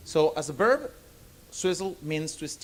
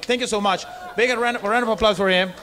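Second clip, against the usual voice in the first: applause call; 6 semitones higher, 6 dB louder than usual. Real, not acted. Calling for applause for helper.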